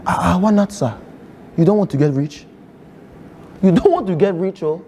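put-on lightly held Nigerian accent, earnest student; a Nigerian student pleading with the teacher. To a geography teacher, a mild Nigerian accent. Persona saying goodbye and pitching scam.

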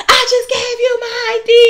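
imitating an irate shopper's shout; angry customer yell. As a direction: bark the complaint like an irate shopper. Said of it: "She's like".

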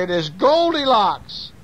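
high, slow story-time exclamation; high-pitched baby bear; excited baby bear. Acted. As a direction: cry out the discovery in a high cub voice. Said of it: "says Baby Bear".

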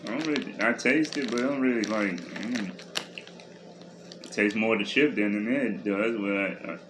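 talking with food in mouth; chewing while talking. Real, not acted. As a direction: mouth partly full of chip. Seen 1: Both just ate chips; woman's mouth works while speaking; speaker unclear.

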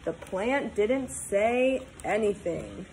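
ordinary delivery, nothing marked

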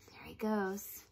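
hushed whisper. Acted. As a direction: whisper it like a secret. Whispered aside in story.

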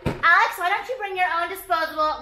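high mocking imitation of a preachy friend; mimicking the lecturers, high and nasal-free. Mocking what others tell her.

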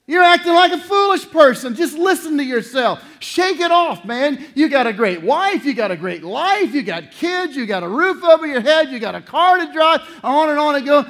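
voicing Jesus snapping a sulker out of it, loud and high; loud wake-up call. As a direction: shake the listener out of sulking. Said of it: "Jesus loves you enough to step into your little pity party and say".

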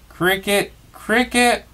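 high, squeaky chirping call; high cricket calling, rising. Acted. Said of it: "it called".

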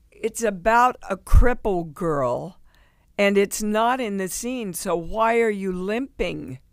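teacher loudly confronting her about the limp; teacher calling out. Retold or imitated. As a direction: nail her with the question, loud.

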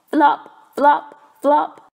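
loud, high bouncy flop flop flop; flopping sounds. A narrator's reading. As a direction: bounce each flop like a clumsy frog.

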